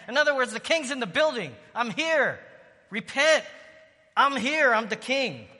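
high, loud fervent preaching; preaching at full force. Real, not acted. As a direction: proclaim the king has come, full voice. Preaching climax, voicing Jesus.

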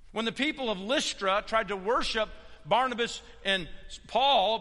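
high, loud pulpit retelling; impassioned preaching. Acted. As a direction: cry out the apostles' protests. Preaches apostles' protests at force.

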